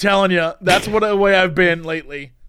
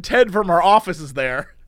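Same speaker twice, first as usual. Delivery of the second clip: usual speaking voice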